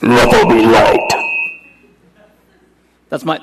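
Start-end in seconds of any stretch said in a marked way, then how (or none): 0.0-1.1 deep, booming, slow trailer narrator; movie trailer voice. Retold or imitated. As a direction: boom it out like an epic movie trailer. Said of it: "like a movie trailer voice".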